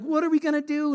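high-pitched imitation of a panicked friend; mock freaking out. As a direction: act out a friend's mild panic. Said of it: "he's, like, freaking out".

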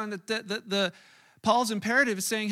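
ordinary talk, nothing marked

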